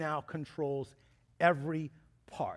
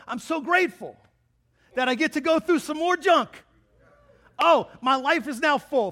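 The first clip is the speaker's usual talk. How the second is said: sarcastic, higher and louder mock gratitude; mock joy; 7 semitones higher, 8 dB louder than usual. Pastor mocking being grateful for more junk, hammed-up sarcastic joy. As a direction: ham up the thankfulness for trials.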